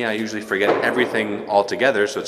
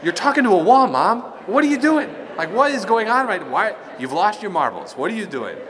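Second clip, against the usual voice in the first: imitating toddler; 9 semitones higher than usual. Toddler baffled at mom talking to wall, high childlike. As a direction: squeak it out like a puzzled kid.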